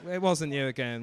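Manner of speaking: ordinary delivery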